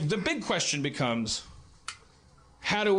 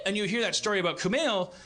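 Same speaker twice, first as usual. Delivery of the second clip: ordinary delivery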